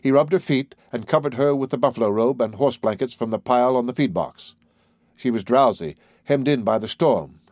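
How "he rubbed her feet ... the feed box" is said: plain reading voice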